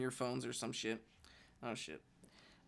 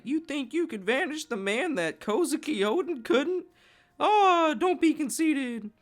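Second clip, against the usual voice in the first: much higher, much louder scolding roar; Whitebeard yelling; 10 dB louder than usual. Retold or imitated. Whitebeard scolding Ace, loud hammed-up yell. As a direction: blast it at him like an outraged captain. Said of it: "He's like".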